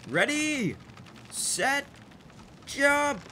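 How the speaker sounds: high, called-out countdown; excited countdown. Real, not acted. Player counting down to a jump, excited call. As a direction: call each word up high, with gaps.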